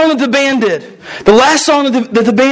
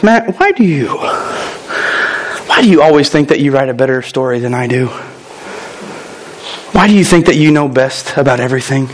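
much lower, quick, probing voice of God; God questioning; 8 semitones lower, faster than usual. Retold or imitated. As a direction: ask low and fast, gently pressing the pastor. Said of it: "maybe God talks like this with you".

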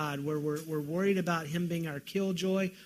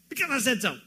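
stern parent; 4 semitones higher, faster than usual. Retold or imitated. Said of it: "you're gonna say".

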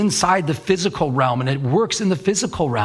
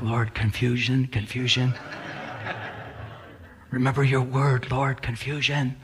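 softly pleading, slow; hushed retold prayer; 2 semitones lower, 7 dB quieter than usual. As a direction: imitate praying quietly under your breath. Retold anxious prayer under breath.

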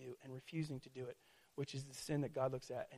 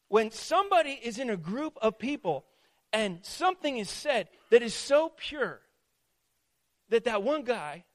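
high, loud phrases set apart by pauses; emphatic preaching; 6 semitones higher, 9 dB louder than usual. Real, not acted. Preacher describing the guy who makes it dirty, emphatic. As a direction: hammer each phrase out to the room.

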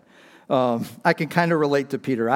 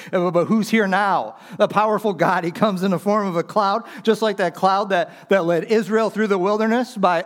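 fiery preaching; 5 semitones higher than usual. Acted. Pastor building the sermon to a peak, full-force preaching. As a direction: preach it out to the whole congregation.

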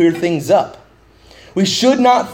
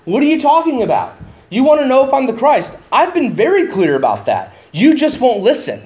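higher, fast exasperated protest; exasperated Jesus; 6 semitones higher than usual. Acted. Jesus rebuking the crowd, higher and exasperated. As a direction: voice Jesus pleading that he was clear. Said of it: "He's saying".

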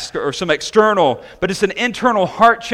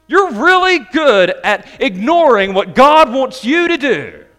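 higher, louder, slow mock praise; sarcastic compliment; 4 semitones higher, 4 dB louder, slower than usual. Preacher voicing Jesus' mock compliment, sarcastic. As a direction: praise them for being crooked. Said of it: "He's complimenting them for being crooked".

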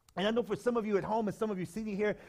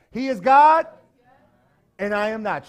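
high, loud call with the congregation; the congregation chanting the refrain, loud; loud refrain together; 5 semitones higher, 14 dB louder than usual. Shown as a demonstration. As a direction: belt it out with everyone. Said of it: "Let's say it together".

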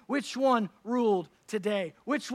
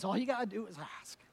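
soft and quick, softer than the room; gentle divine invitation; 6 dB quieter, faster than usual. Acted. God speaking to struggling listeners, soft and gentle. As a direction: offer it gently, like a kind promise. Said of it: "And he says".

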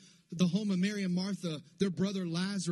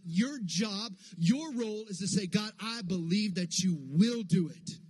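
higher, drawn-out, emphatic preacher delivery; full-force preaching; 4 semitones higher than usual. Real, not acted. Preacher urging the church to keep believing, full-force preaching. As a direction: preach it with conviction, driving each phrase home.

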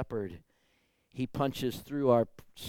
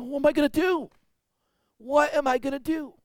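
high, louder retold alarm; retold panic; 8 dB louder than usual. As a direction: throw up your hands in a high, louder alarm. Pastor retells his panicked question.

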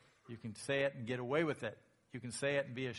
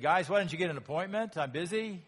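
fast, louder impatient dismissal; mock brush-off; 4 semitones higher, 7 dB louder than usual. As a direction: wave them off, too busy to care. Imagined impatient Jesus brushing off.

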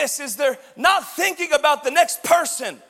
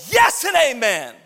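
shouting amen; 5 dB louder than usual. Retold or imitated. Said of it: "people standing up on their chairs screaming".

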